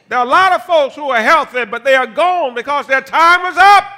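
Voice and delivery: loud high sermon climax; preaching. Acted. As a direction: build to a shouting peak.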